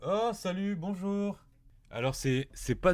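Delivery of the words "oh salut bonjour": high-pitched greeting. Acted. Greeting aliens at the porthole.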